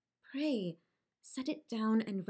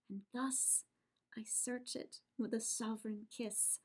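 very soft, intimate murmur; softly to the beloved's name; hushed tenderness; 11 dB quieter than usual. Acted. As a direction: breathe it over the letter as a kiss. Julia kissing the torn letter.

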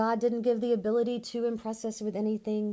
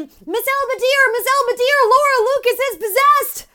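much higher, much louder panicked yelling; 18 dB louder than usual. Retold or imitated. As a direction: shout to wake a sleeping mother in terror.

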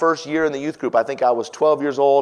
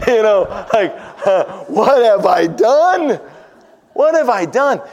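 much higher, louder, half-laughing lament; laughing despair; 9 semitones higher, 8 dB louder than usual. Real, not acted. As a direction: cry out in mock horror through laughter. Laughing despair at own deed.

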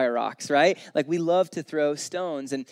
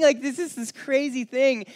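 incredulous; 9 semitones higher, 4 dB louder than usual. Real, not acted. After mocking Karen.

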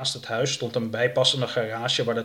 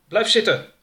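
loud, high-pitched order; commanding; 10 semitones higher, 7 dB louder than usual. A narrator's reading. Jake to his captive before leaving, sharp command. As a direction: order the captive to stay put. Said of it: "beval Jake".

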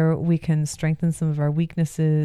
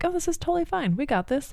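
breezy reassurance; 12 semitones higher, 4 dB quieter, faster than usual. Retold or imitated. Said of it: "I'm like".

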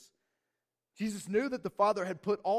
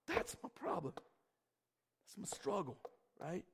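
slow whisper, much softer; confessional whisper; 8 dB quieter, much slower than usual. Real, not acted. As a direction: let it out like a confession. Confessing personal struggle.

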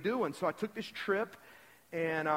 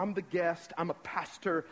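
normal talking voice

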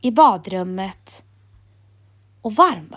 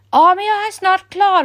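much higher, louder call from the shower; 11 semitones higher, 6 dB louder than usual. A narrator's reading. Emil calling from the shower to his mother, loud call. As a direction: shout back over the water. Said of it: "ropar Emil".

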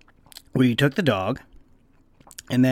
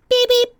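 bird beeps; 4 dB louder than usual. Retold or imitated. Mimicking the parrot's call.

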